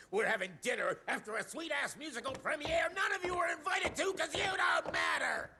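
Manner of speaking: fast, rising, yelled insult; angry shout. Acted. Secretary sneering at the team as he leaves, angry shout. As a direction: spit it out and storm off.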